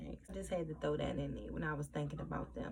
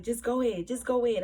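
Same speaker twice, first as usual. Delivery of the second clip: mock urgent shout; 5 semitones higher, 7 dB louder, faster than usual. Retelling Sid urging in shootout.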